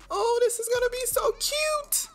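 high-pitched excited squeal; gushing delight. Real, not acted. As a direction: gush over something adorable. Gushing over gift for child.

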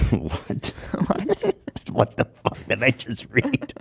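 stunned double take; reading in disbelief. Real, not acted. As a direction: react in total disbelief. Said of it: "Let me read that again".

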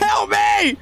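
high-pitched urgent shout; alarmed gamer yell; cry for help. Real, not acted. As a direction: call out to teammates in a panic. Chased by trolls, calls for help.

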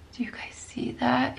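hushed, breathless whisper. Real, not acted. Vlogger whispering to viewers about the sparkle.

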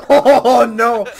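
loud, high, drawn-out wail; laughing-edged cry of defeat; wailed dismay. Real, not acted. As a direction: wail it out as all is lost. Player failing in game.

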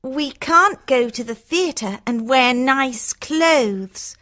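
drawn-out spoiled complaint; complaining Hortensia. Acted.

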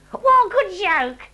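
gleeful dimwit enjoying the joke; Fred the Baddie's voice. A narrator's reading. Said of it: "Said Fred the Baddie".

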